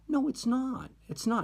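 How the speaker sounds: very high-pitched mock denial; retold contradiction. Friend disputing the student's claim of racism, retold denial. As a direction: contradict her in an acted argument.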